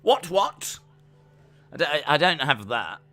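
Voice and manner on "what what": put-on high-pitched caricature British accent; typical British. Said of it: "like, a typical British".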